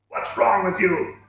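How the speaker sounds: slow, angry other voice. A narrator's reading. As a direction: demand it angrily from the dark. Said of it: "asked an angry voice".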